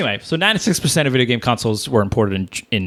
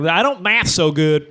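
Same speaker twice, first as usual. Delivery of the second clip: higher, stupid Southern voice; Southern accent; 6 semitones higher than usual. Pretend. Host repeats a math joke for laughs, mock Southern accent. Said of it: "I always give stupid of Southern every time".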